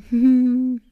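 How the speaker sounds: nervous laugh. Real, not acted. Uneasy reaction to creepy news.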